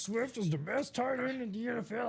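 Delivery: high put-on quarterback voice; Patrick Mahomes. Co-host as quarterback praising tight end, high-pitched impression. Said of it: "went back into my Patrick Mahomes".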